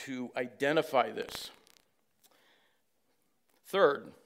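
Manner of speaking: loud, driving sermon voice; full-force preaching. Acted. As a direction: preach it hard to the congregation.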